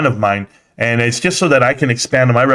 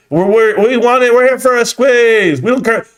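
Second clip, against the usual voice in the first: high, louder mock whine; mocking quote; 10 semitones higher, 3 dB louder than usual. Streamer mimics viewers who want a squeeze, mocking. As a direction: parrot them in an exasperated high voice. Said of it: "Why do people always say".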